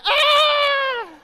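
very high, very loud terrified scream. Retold or imitated. Wife terrified in the air pocket, a long loud scream. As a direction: let out a full panicked scream. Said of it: "started screaming".

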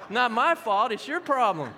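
loud, high-pitched defensive protest; hammed-up indignant denial; mock blame-shifting. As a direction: imitate someone throwing the blame back. Voicing people who blame others.

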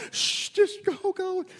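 high, strained, frantic hushing; acted frantic urging. Leader pushing the girl as they run, acted frantic urging. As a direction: urge her on in mock panic.

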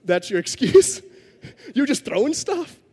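can't hold back a laugh at the excuse; laughing in disbelief. Retold or imitated. Pastor reliving laughing at the student's excuse, laughing disbelief. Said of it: "I'm just laughing".

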